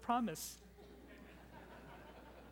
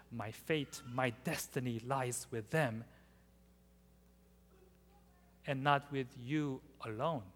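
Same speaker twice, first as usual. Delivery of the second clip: quiet resolve; 5 semitones lower, 7 dB quieter than usual. Acted.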